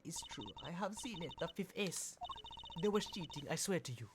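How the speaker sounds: uncertain, put-on Russian accent; attempted Russian accent. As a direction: plead in a dubious Russian accent. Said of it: "I don't know if that's a Russian accent".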